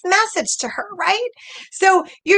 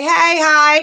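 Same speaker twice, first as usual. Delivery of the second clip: right greeting; 5 dB louder than usual. Shown as a demonstration. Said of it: "What do you say?".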